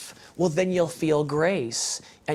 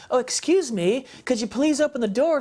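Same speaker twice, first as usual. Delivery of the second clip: higher, overly polite mock request; 6 semitones higher than usual. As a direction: play a timid, apologetic knocker. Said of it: "not a little polite little tap".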